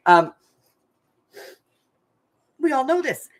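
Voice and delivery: normal talking voice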